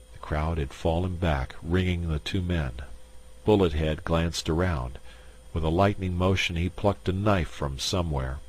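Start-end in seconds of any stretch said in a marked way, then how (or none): none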